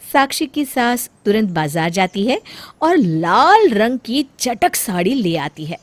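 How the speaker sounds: usual speaking voice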